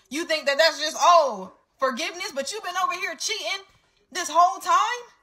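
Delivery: high, rising indignant outburst; indignant outrage. Real, not acted. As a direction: hit it high in disbelief. Indignant rant at cheater.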